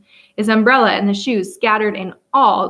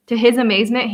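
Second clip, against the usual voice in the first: ordinary delivery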